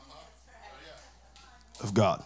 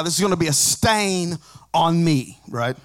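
ordinary talk

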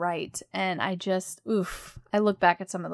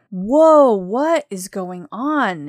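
retold shock, bursting in loud then easing; shocked dad; 8 dB louder, much slower than usual. Dad finds his child on the floor, shocked. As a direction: imitate a stunned father, loud "whoa" then slower. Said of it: "he was so shocked".

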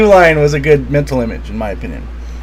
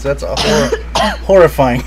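ordinary talk

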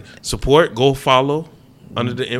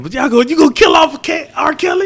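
incredulous. Real, not acted. Incredulous question about killing off.

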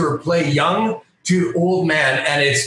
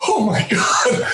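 startled gasp; 7 semitones higher, slower than usual. Retold or imitated. Fan realizing his hero stands behind him, startled. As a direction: jump at the sudden voice.

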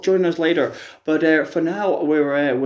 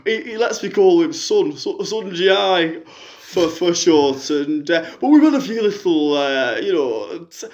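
a lightly put-on middle-aged fan on the phone; a fan phoning in with a sob story, lightly voiced; [name] caller voice; 3 semitones higher than usual. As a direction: play the hard-up caller, chatty and woeful. [name] voice continues.

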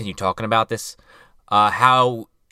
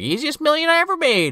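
much higher mock villain voice; smug villain line. Retelling Blaine's line in a high mock villain voice. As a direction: squeak it out as a cartoon villain. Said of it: "He's like".

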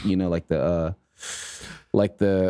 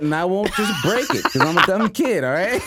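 put-on higher, defiant dumb-kid voice; a friend mocking the kid's excuse, higher defiant voice; 7 semitones higher than usual. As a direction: whine it like a cheeky kid. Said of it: "voicing the kid's excuse".

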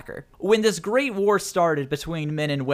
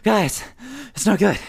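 breathless worry; 4 semitones higher than usual. Acted. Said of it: "he's like".

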